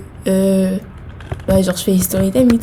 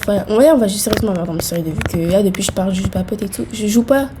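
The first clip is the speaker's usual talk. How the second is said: ordinary delivery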